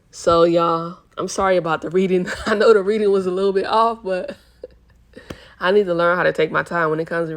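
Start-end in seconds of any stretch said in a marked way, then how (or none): 1.2-5.6 fast, laughing apology; sheepish laughing apology to viewers. Real, not acted. Laughing apology about her reading.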